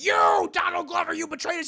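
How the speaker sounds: very high, loud accusing shout; mock outraged yell. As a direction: imitate an over-the-top furious accuser. Acted accusation by arms dealers.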